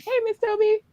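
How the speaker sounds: high-pitched sing-song hello; mock her cheery hello; imitating a greeting. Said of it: "you're like".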